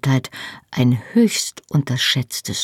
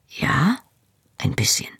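half-choked whisper; 3 dB quieter than usual. A narrator's reading. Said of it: "Er klang halb erstickt".

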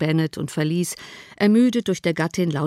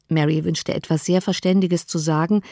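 plain reading voice

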